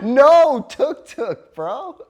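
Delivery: high, slow, loud laughing no; laughing no to a pushy tuk-tuk driver; laughing refusal. Retold or imitated. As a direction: wave him off through laughter. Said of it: "and I'm like".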